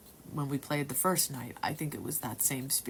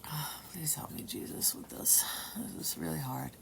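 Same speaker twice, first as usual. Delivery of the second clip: hushed, pleading whisper to Jesus; whispered prayer; 7 dB quieter than usual. Retold or imitated. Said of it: "she starts saying this stuff to the spirit".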